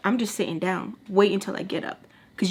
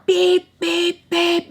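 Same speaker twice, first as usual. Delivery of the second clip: high loud mock honking beeps; car horn beeps; 10 semitones higher, 5 dB louder, much slower than usual. Mimicking the car horn blaring at the arguing women. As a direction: imitate a car horn going beep.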